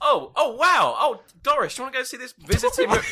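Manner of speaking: put-on excited old cruise passenger. Pretend grandson on a cruise calling to grandmother Doris, gushing. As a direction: squeal with delight at the lecture, hammed-up.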